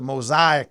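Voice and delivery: Mosaic said as mo-ZY-ek; foreign pronunciation. Shown as a demonstration. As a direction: say Mosaic with an "eye" sound in the middle. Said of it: "how they say it in some countries".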